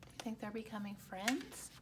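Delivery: plain reading voice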